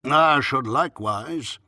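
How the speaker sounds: slow, loud, portentous doctor; increased solemnity. A narrator's reading. Doctor continuing his advice, with increased solemnity. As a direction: draw it out with ponderous weight. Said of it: "said the doctor with increased solemnity".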